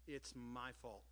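very soft and low; soft, understated owning of blame; quiet confession. Retold or imitated. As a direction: drop to a hushed, humble admission. Quiet voice interrupts his imagined tirade.

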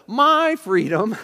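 "my" stretched out, high and loud; stress on MY. Acted. Preacher mimicking self-centred believers, heavy stress on "my". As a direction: make "my" a loud, slow, possessive claim. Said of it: "the emphasis on".

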